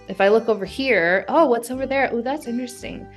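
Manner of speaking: ordinary delivery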